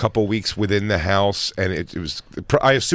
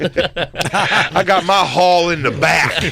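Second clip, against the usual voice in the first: slow drawl; 5 semitones higher, much slower than usual. Pretend. Playing farm trader bit.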